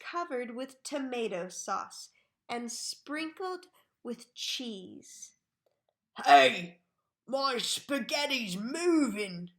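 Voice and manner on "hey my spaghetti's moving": a loud, shocked shout; Mister Twit's startled cry. A narrator's reading. Mister Twit shouting at his moving spaghetti, startled. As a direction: yell it in alarm at the plate. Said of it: "cried Mister Twit".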